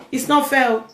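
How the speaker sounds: half-joking it's-not-fair whine; playful complaint. Real, not acted. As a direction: tease them for not talking. Teasing silent male viewers.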